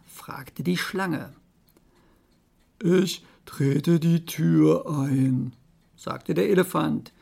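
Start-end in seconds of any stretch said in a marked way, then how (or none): 2.8-5.5 very slow, ponderous elephant. Acted. Elephant offers to break the door, slow and ponderous. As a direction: stretch each word like a lumbering elephant.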